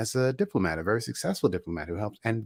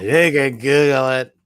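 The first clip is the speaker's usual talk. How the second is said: loud punchline; 4 semitones higher, 9 dB louder than usual. Pretend. Repeats line louder.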